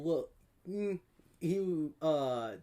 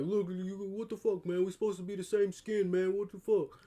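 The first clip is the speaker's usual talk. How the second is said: higher-pitched imitated protest; retold indignation; 6 semitones higher, 3 dB louder than usual. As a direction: complain indignantly, playing the aggrieved guy. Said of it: "he's like".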